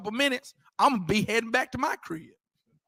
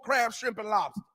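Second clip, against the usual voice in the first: usual speaking voice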